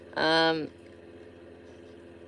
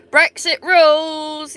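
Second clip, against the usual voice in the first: sarcastic cheer; 6 semitones higher, 7 dB louder, slower than usual. Acted. Sarcastic cheer about Brexit.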